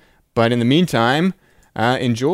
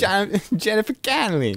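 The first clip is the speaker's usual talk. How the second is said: high laughing Brooklyn echo; host echoing the Brooklyn bit; Brooklyn accent; 9 semitones higher than usual. Retold or imitated. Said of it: "they both have Brooklyn accents".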